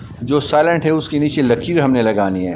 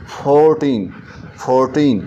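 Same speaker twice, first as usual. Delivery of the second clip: Fourteen repeated with फोर stretched; long stressed FOR. Shown as a demonstration. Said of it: "फ़ोर है इसको हम long करते हैं".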